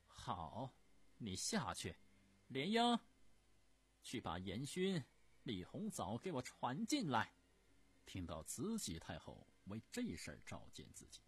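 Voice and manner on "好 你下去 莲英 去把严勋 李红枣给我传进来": loud, high call for the eunuch; imperious command. Acted. Empress dowager calling Lianying loudly to summon the officials. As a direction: bark the order across the hall.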